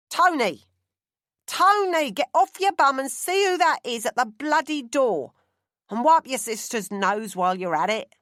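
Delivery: loud, high shout across the house; harried mother hollering through the house; yelling for her son. Acted. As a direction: bellow at a lazy son from another room.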